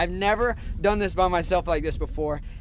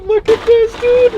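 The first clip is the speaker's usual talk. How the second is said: high, loud show-off call; excited; 11 dB louder, slower than usual. Real, not acted. Diver showing off his grouper, loud and thrilled. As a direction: thrust it at the camera with glee.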